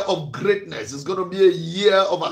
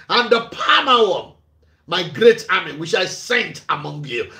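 solemn scripture reading; 4 semitones higher than usual. Acted. Reads scripture aloud.

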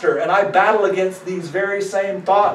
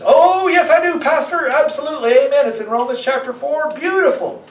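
higher, gushing churchgoer voice; eager churchgoer; 6 semitones higher than usual. Retold or imitated. Pastor mocking a churchgoer agreeing too eagerly, high and gushing. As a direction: chirp it like a keen believer. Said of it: "But if I challenge you to say".

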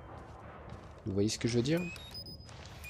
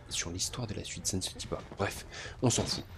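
soft whispered correction; whispered aside; 4 semitones lower, 8 dB quieter than usual. Real, not acted. Quick aside to viewers.

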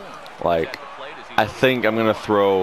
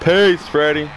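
gloating taunt; 6 semitones higher, 5 dB louder than usual. Real, not acted. Taunting struck-out batter.

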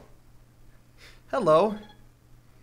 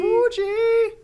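excited call; 4 dB louder than usual. Pretend. Naming the hero playfully.

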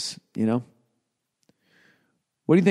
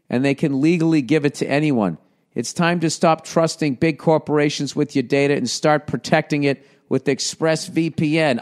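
upbeat sponsor read; 4 semitones higher than usual. Acted. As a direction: read it like a podcast ad. Reads the ad copy.

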